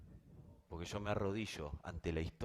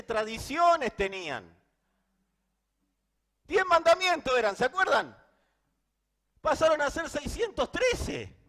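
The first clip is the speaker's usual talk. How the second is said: fervent preaching; 12 dB louder than usual. Real, not acted. Preaching at full force.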